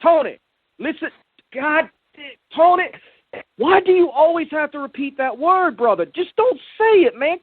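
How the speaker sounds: frustrated friend pleading with Tony to stop saying it; exasperated pleading. Acted. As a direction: beg him to stop, fed up.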